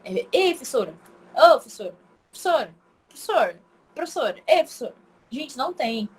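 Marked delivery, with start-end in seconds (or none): 0.3-4.9 "professora" cut to "fessora" and "psora"; students' swallowed syllables. Retold or imitated. Students pestering their teacher, clipped word. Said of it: "nenhum aluno que me chama de professora".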